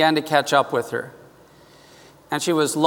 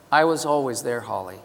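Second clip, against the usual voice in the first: soft, lower, gentle but firm; soft reassuring; 5 semitones lower, 5 dB quieter than usual. Retold or imitated. God answering angry Holly, soft and reassuring. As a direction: hush it lovingly, like a comfort. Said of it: "Soft, gentle, but firm, loving, reassuring".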